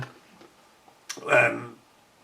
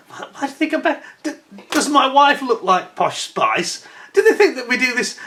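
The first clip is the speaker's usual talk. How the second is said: retold incredulous protest; 10 semitones higher than usual. Retelling his incredulous protest to doorman.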